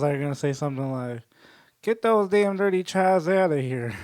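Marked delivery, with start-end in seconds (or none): 1.8-3.9 put-on higher, indignant Southern accent. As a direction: gripe in a hammed-up Southern accent. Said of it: "once you attach a Southern accent".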